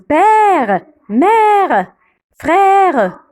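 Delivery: "père, mère, frère" with long, wide-open è. Shown as a demonstration. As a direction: stretch the open è in "père, mère, frère", one word at a time. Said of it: "On ouvre la bouche".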